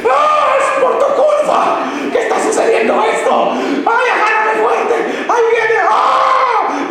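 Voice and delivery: wife in labour yelling at her husband, hammed-up shrieks; woman screaming in labour. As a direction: ham up a furious wife giving birth. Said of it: "No, le dicen".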